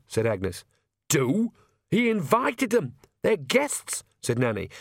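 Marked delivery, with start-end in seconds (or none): 1.1-4.0 put-on high-pitched indignant old woman; pitched well up in disbelief; indignant retort. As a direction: throw the question back, exasperated. Nanny retorts to Agnes's question.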